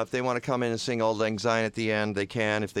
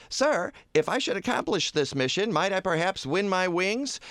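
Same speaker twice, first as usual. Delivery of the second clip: higher, eager, timid angel voice; higher bashful angel pleading for his wings; Clarence the angel; 6 semitones higher than usual. Acted. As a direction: ask shyly as the hopeful angel Clarence. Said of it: "send for Clarence".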